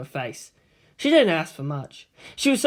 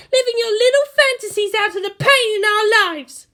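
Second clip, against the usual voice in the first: much higher, louder contemptuous tirade; furious Asuka; 6 dB louder than usual. A narrator's reading. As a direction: spit your disgust at them. Asuka insults the girls.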